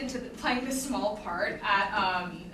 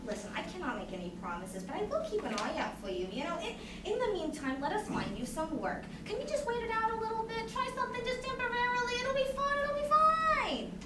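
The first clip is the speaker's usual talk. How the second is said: higher and higher, coaxing; sugary recruiter; 10 semitones higher than usual. Retold or imitated. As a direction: soothe a crying kid with promises. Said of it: "She said".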